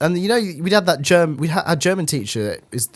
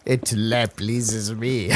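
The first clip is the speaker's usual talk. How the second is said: very slow, softer put-on French accent; mock French accent; 4 semitones lower, 5 dB quieter, much slower than usual. Friend plays a smug fake French teacher, slow mock French accent. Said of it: "spoke with a French accent".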